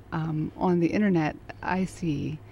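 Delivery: ordinary delivery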